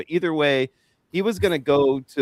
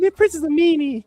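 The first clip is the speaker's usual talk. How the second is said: very high falsetto impression, a bit louder; 6 dB louder than usual. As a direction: put on a pop star's high voice to complain. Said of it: "he does his impression of [name]".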